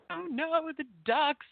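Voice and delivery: high, mock-distressed wail; upset. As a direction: play up her fretting over the ducklings. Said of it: "getting upset to it".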